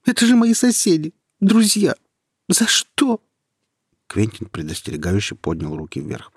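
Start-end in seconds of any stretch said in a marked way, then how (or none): 0.1-3.2 high, loud grieving wail; tearful anguished cry. A narrator's reading. Nika weeps over her dead neighbours, high anguished cry. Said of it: "Слёзы катились по щекам девушки".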